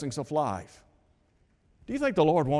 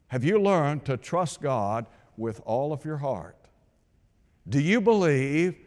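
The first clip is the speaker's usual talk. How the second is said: ordinary talk, nothing marked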